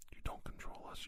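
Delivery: usual speaking voice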